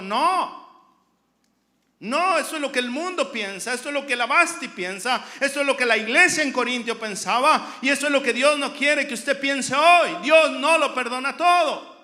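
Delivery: loud, driving sermon delivery; full-force preaching. Real, not acted. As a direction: preach it out hard, phrase on phrase.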